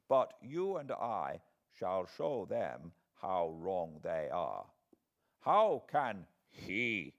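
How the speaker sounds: put-on gentle elderly scholar, taking his time; old foreign professor. A narrator's reading.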